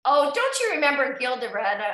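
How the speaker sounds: normal talking voice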